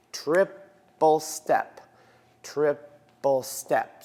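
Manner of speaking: slow, measured counting of steps; counted dance steps. Shown as a demonstration. Dance teacher to class, slow counted steps. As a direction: call the steps out in time. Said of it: "I want this to be right underneath me".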